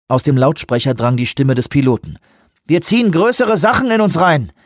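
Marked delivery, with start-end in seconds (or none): 2.6-4.5 raised, higher, over the loudspeaker; a pilot on the radio trying to stay on top of a crisis; urgent pilot report. Acted. Pilot reports unstable suction over speaker.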